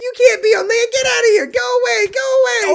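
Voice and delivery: angry shooing shout. Acted. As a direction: yell at the fish until it leaves. Gold chases the fish away.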